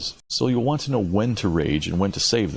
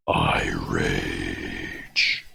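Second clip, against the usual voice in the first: deep subdued rage. Shown as a demonstration. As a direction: growl it low and held in. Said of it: "a deep, subdued rage".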